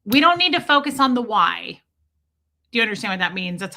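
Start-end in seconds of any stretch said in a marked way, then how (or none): 0.0-1.8 louder, higher telling-off; firm mock correction, voice raised; imitated reprimand. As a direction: mimic a supervisor scolding a student. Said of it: "would always reprimand me and go".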